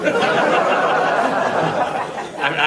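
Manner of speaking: loud breathy laugh of refusal; loud amused laugh; hearty laugh. Real, not acted. Refuses to do accent on demand.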